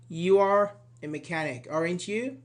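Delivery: "aren't you" lifted at the end; rising tag intonation. Shown as a demonstration. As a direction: state it, then ask with a rising "aren't you". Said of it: "we use a rising intonation".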